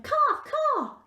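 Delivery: high, loud cawing bird; high-pitched bird cry; raven caw. Acted. Raven cries from branches.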